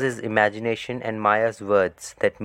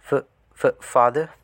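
higher, weak stammer on father; stuttering weakly; 5 semitones higher than usual. A narrator's reading. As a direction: stammer the word out faintly.

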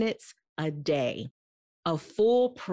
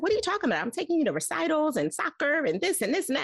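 much higher, defensive protest; 9 semitones higher, faster than usual. Retold or imitated. Mom defending her busy schedule to daughter, high defensive protest. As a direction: rush to defend yourself. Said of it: "jump into defending".

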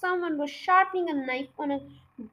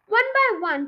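ordinary delivery, nothing marked

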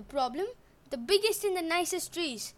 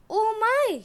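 Oh my, high and loud, falsely sweet; a disguised goddess playing coy; sweet maiden; 8 semitones higher, 8 dB louder than usual. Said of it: "she said".

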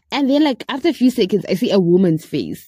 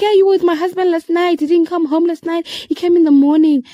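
high, quick mock yell, louder at the end; retold shouting; 9 semitones higher, 3 dB louder than usual. Wife accusing her over last night, retold shouting. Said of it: "the woman shouted at me".

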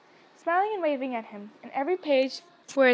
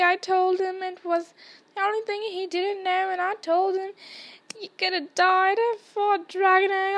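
a hint of a blubbering Hagrid, higher; gushing remorse in a raised voice; lightly voiced tearful giant; 5 semitones higher than usual. A narrator's reading. Hagrid to Harry, tearfully blaming himself, higher pitched. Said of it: "burst into tears".

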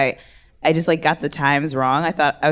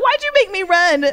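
whiny complaint; 12 semitones higher than usual. Retold or imitated. Complaining after rushing.

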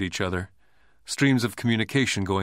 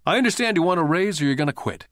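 lightly voiced businessman, quick; a hint of a brisk boss; brisk older man; 7 semitones higher, faster than usual. A narrator's reading. Rich Dad voiced in story.

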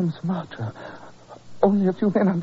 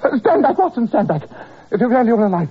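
much higher, louder cry to stay away; sick man shouting his friend back from the bed; urgent warning shout; 6 semitones higher, 4 dB louder than usual. Acted. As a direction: fend him off with a frantic high yell. Sick Holmes warns Watson off.

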